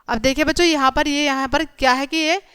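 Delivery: ordinary delivery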